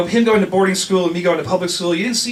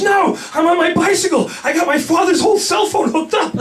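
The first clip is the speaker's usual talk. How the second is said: high, loud gleeful shout; excited shout; 11 semitones higher, 4 dB louder than usual. Retold or imitated. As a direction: boast it at the top of your voice, much higher. Friend's excited call, quoted.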